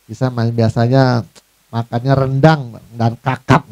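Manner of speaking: a lightly voiced snob turning his nose up; snobbish boast. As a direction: brag about fancy food like a snob. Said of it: "Hati-hati".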